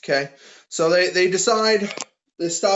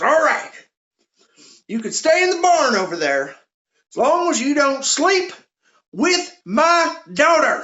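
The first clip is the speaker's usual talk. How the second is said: put-on high-pitched old hillbilly; hillbilly farmer; 10 semitones higher, 4 dB louder than usual. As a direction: warn them in a loud, high farmer voice. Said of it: "this old farmer hillbilly man comes out".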